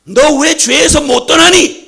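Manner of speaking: loud, slow, high rebuke; the Lord loudly rebuking a listener; rebuking question. Acted. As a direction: drag out the reproach loudly. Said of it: "라고 물으시면".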